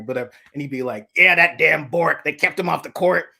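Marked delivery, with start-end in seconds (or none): 1.2-3.3 lightly voiced griping mechanic, pitched up; Southern garage guy. Garage guy griping about Bork, lightly voiced.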